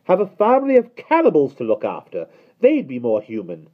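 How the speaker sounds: high, loud, disdainful nanny; sniffy. A narrator's reading. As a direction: look down your nose. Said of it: "she remarked with a sniff".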